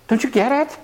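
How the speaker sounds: exasperated. Retold or imitated. Exasperated quote of frustrated person.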